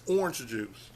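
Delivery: orange said as "ornj"; "ornj juice". Shown as a demonstration. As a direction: stick to an "or" sound in orange. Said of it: "I pronounce the O R".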